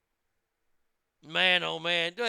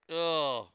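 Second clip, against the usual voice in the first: drawn-out "ugh" of disgust; disgusted groan; 2 semitones lower than usual. Real, not acted. Host reacting to rotten meat, disgusted groan. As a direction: groan in revulsion.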